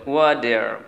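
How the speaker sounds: ওয়াটার as "wa-der", r sounded; American t as d. Shown as a demonstration. Teacher showing what Americans call water, ওয়াটার as "wader". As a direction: turn the t of ওয়াটার into a d. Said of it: "ট সাউন্ডকে অনেকটা ড করে দেয়".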